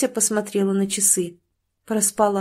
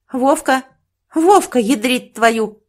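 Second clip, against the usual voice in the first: higher, louder yell of his name; angry shout; 4 semitones higher, 7 dB louder, slower than usual. A narrator's reading. Sister barging in on her lazy brother, angry shout. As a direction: storm in and bellow at him.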